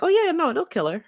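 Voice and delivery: breezy and offhand; casual. Retold or imitated. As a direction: toss it off lightly, high pitched. Characters casually dismissing death.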